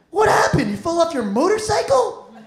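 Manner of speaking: a doctor gawking at the injury; lightly voiced amazed doctor; mock doctor. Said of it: "He's like".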